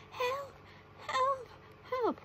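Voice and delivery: very high cries for help; screeching. A narrator's reading. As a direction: screech it like a panicking goblin.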